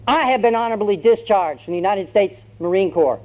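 high-pitched, defiant announcement; bold declaration. Acted. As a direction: declare your freedom to the sergeant. Recruit now defies the sergeant.

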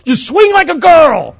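hammed-up angry sports dad; mock shouting dad. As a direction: yell it from the stands like an obnoxious father. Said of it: "He would say".

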